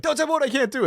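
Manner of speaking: high, hammed-up protest; imitating a high knee-jerk protest; mock outburst. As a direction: toss it off as a squeaky reflex. Body's knee-jerk quote, reprised.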